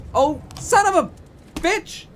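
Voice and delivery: high, loud, slow shocked curse; surprised outburst. Real, not acted. Streamer reacts to the telegram, shocked high curse. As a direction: blurt it out in real shock.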